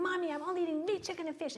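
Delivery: put-on high, quick child's voice, insisting; little girl's insistent voice. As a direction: imitate a young daughter repeating herself. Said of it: "She kept going, going".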